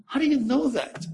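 high-pitched retold astonishment; astonished. Visitor to the mother reciting Hebrew, astonished.

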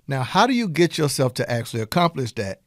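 ordinary talk

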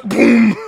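explosion sound effect; loud mouth-made boom; last noise. Pretend. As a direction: imitate a self-destruct blast. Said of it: "that would be my last noise".

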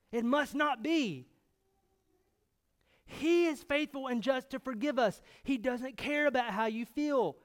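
forceful, urgent sermon delivery; impassioned preaching. Real, not acted. Preacher urging the congregation about the gospel, impassioned. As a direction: drive it home to the congregation.

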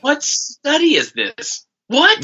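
high, slow, squeaky disbelief; incredulous. Real, not acted. Cohost balks at the sexy accent ranking, high and incredulous. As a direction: stretch it out in disbelief.

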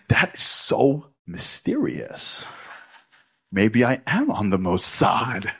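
slow, far-off conspiratorial tone; mock mysterious. Speaker imagining a spy package, slow mock mystery. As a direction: draw it out like a spy thriller.

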